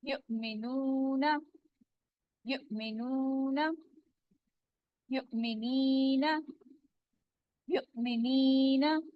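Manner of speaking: यु'मिनूना drawn out slowly and softly; slow recitation. Shown as a demonstration. Teacher slowly modelling यु'मिनूना for students. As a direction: stretch each syllable of यु'मिनीना. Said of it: "teacher models words slowly".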